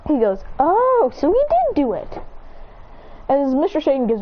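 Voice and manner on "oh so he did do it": dawning realisation. Acted. Goten realising the trick.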